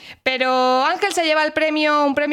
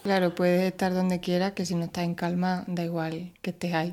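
hushed; 8 semitones lower, 8 dB quieter than usual. Real, not acted. Hushed reflection on being calm.

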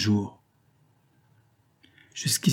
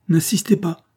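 cutting him off, higher and a touch louder; a young woman refusing, sharp and raised; sharp interruption; 7 semitones higher, 4 dB louder than usual. A narrator's reading. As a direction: stop him short, high and firm. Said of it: "interrompit Véronique".

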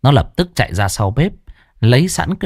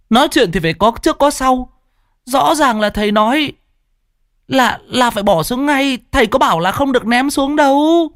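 high, fast self-righteous argument; righteous boy; 12 semitones higher than usual. Acted. Righteous pupil argues he only obeyed his teacher. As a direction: argue it out like an indignant little lawyer. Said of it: "bộ mặt đầy chính khí, hùng hồn".

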